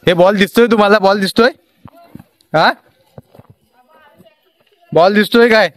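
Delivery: shouting across a distance. Real, not acted. Calling across to kids playing cricket at dusk, loud shout.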